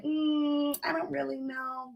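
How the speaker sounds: a soft, unsure mumble that trails off; mock unsure. Speaker mocks a listener with no vision, softly unsure. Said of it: "Are you just like".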